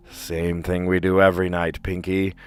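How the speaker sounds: scheming villain declaration, swelling on the last line; Brain. Acted. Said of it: "Pinky and the Brain".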